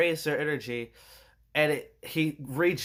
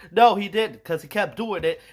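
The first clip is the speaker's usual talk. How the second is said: ordinary talk, nothing marked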